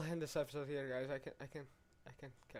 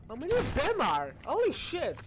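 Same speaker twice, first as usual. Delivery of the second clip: loud, high exclamations; high-pitched, startled yells; alarmed shouting; 9 semitones higher, 6 dB louder than usual. Real, not acted. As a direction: shout in alarm at being swamped. Overwhelmed by game enemies.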